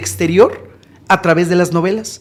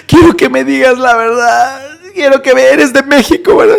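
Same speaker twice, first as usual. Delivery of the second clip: high, wailing imitation of anguish; imitated heartbroken plea; 6 semitones higher than usual. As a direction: plead as if your heart is tearing. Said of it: "el corazón se le desgarraba y decía".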